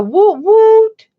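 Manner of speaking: high, loud, drawn-out cheer; cheering whoop. Real, not acted. Daughter asks chat to cheer for mom, cheering whoop. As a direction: whoop it up slowly and loudly.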